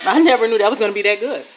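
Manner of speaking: loud, fast, laughing delight. Real, not acted. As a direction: talk through a real laugh.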